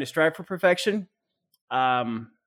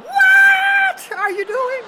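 high, loud laughing shout; shocked shout; 9 dB louder, much slower than usual. Acted. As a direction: scream in disbelief, cracking up. Partner's absurd suggestion.

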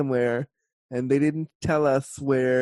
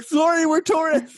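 much higher, squeaky plea; mock helpless apology; 13 semitones higher than usual. Tourist answers annoyed train staff, mock helpless apology. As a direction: retell it as a high, comic excuse.

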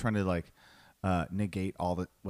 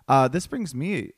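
usual speaking voice

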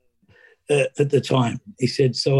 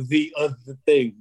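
mocking echo. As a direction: lightly mimic him saying the other thing, a bit coy. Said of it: "Don't look at me with those eyes and go".

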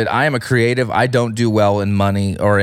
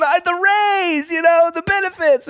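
timid assistant. Retold or imitated. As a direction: squeak it out, embarrassed to say it. Assistant quoted, exaggerated voice.

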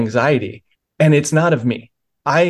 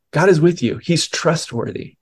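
ordinary talk, nothing marked

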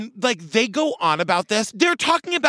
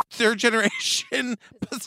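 slowing down as laughter breaks in; real giggles derailing the sentence; cracking up; slower than usual. Real, not acted. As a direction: fumble the word through a laugh. Cracks up while fumbling the words.

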